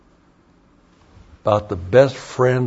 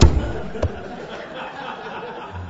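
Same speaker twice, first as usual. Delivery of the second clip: soft airy laugh, high; a laugh after joking about crying on the phone; breathy laugh; 7 dB quieter than usual. Real, not acted. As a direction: let out a quick breathless laugh.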